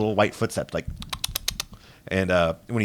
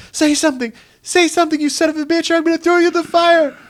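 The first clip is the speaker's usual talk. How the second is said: much higher, louder frantic threat; threatening the doll; 5 dB louder than usual. Acted. Woman threatening to throw the doll in the fire: high frantic shout. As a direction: scream at the doll to talk. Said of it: "she's like".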